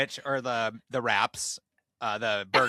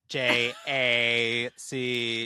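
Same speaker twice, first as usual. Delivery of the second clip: slow spelled-out letters; much slower than usual. Retold or imitated. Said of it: "Jack's like".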